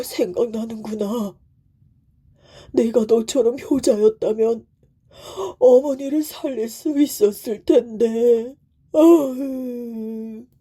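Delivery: weeping beast, full of regret; tearful tiger. A narrator's reading. Tiger mourns own dead mother, crying. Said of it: "눈물을 철철 흘리지 뭐예요".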